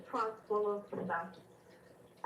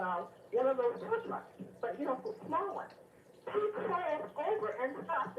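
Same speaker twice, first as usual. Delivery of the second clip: usual speaking voice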